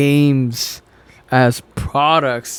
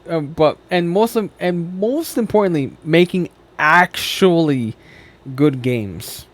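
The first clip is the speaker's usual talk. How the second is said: ordinary talk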